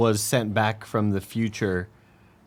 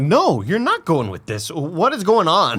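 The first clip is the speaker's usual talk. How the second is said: slow, higher insistent refusal; protective mom; 7 semitones higher, 3 dB louder, much slower than usual. Retold or imitated. Imagined mother refuses her son, slow and firm. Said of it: "I'd be like".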